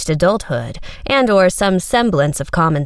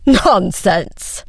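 irritated laugh. A narrator's reading. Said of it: "laugh held a distinct edge of irritation".

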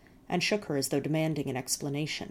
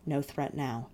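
usual speaking voice

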